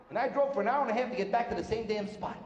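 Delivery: usual speaking voice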